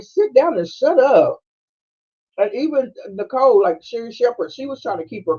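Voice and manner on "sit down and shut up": higher, louder, slow command; telling off a character; mock yell. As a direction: bark it at the screen. Yelling at screen character.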